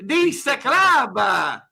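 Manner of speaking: very slow, drawn-out Italian "Lisa Club"; mock Italian. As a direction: stretch every syllable in mock Italian.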